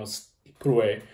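usual speaking voice

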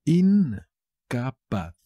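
very slow "Incapaz", long first syllable; teacher's slow, split-up "Incapaz". Shown as a demonstration. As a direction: draw out "In", then "ca", then "paz" in "Incapaz". Word repeated split up for learners.

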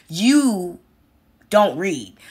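don't dropped low and heavy; stress on don't read. Real, not acted. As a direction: land hard on don't read. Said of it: "repeated for cutting emphasis".

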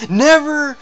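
loud, high NEVER; emphatic warning. Acted. God warning the climber, emphatic.

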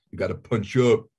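a light put-on tough-guy voice; lightly voiced hard-nosed mentor; mock tough mentor. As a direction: punch it out like a gruff Hollywood veteran. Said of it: "that's something he would say".

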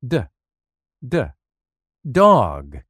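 the D sound spelled out, then dog; phonics drill. Shown as a demonstration. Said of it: "Listen for the beginning sound".

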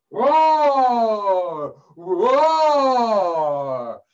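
loud, high, long-drawn roars; roaring lion. Acted. Storyteller plays the lion caught in a trap, roaring loudly. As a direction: roar like a trapped, angry lion.

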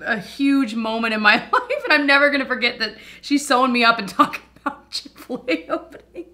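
real giggling disbelief, voice rising; amused laughing. Real, not acted. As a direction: laugh your way through the words. Laughs at doctor's chatter during surgery.

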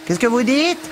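high, loud shouted question; yelling. A narrator's reading. Missus Biggs yells at Zipser over the vacuum cleaner's din. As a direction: yell over a roaring machine. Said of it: "hurla missus Biggs au milieu du tintamarre".

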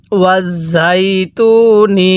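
ওয়াজ ঝাই তু নি with held ঝাই and long তু; drawn-out recitation. Shown as a demonstration. Teacher shows learners how to read, drawn-out ওয়াজ ঝাই তু নি. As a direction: stretch ঝাই and তু evenly. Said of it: "কিভাবে পড়তে হবে".

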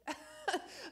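short high laugh at herself; embarrassed little laugh; self-mocking laugh. Real, not acted. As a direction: laugh at your own foolishness.

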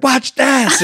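put-on high, loud show-off child voice; cocky kid. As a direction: crow it like a daredevil kid. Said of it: "And he's just like".

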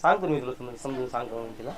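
very soft, subdued promise; calm her down under your breath; hushed placating. Acted. Husband placates angry wife.

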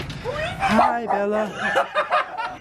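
very high sing-song greeting to a dog. Real, not acted. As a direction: coo at the dog.

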